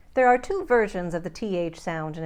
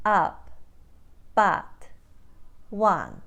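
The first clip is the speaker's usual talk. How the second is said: Ukrainian "Up, but, one" with wide AH vowels; Ukrainian accent; much slower than usual. Shown as a demonstration. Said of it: "become ah".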